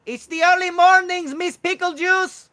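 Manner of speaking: high, loud bird voice; kookaburra messenger. Acted. Messenger waking Miss Pickle Juice, high kookaburra call. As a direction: sing out high and loud, drawing out the end. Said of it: "a blue kookaburra".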